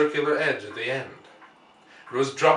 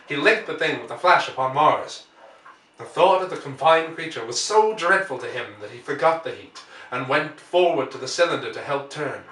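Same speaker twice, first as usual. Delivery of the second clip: ordinary delivery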